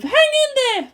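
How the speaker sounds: high urging voice; encouraging. Acted. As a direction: urge her to hold on. Masato encourages.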